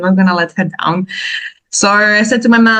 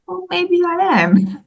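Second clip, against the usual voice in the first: playful admission; 12 semitones higher, slower than usual. Real, not acted. Joking back at doubting people.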